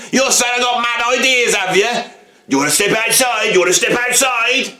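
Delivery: high, squaring up to fight; mock threatening bloke. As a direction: invite him outside with hammed-up menace. Acted pub confrontation.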